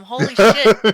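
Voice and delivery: loud burst of disbelief; loud startled swear; shocked exclamation. Real, not acted. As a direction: blurt it out, stunned. Reacting to goofy character art.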